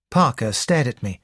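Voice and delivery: plain reading voice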